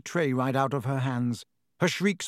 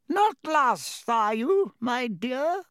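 much higher, sly crone voice; creepy old witch; 10 semitones higher, slower than usual. Acted. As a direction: coo it like a leering hag. Aged witch leering at Harry.

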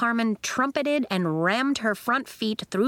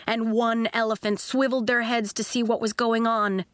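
plain reading voice

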